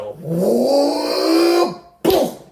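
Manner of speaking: rising whoop into a loud boom; imitated truck noise. Driver recounting the floored truck failing, vocal sound effects. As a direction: slide way up, then punch the boom.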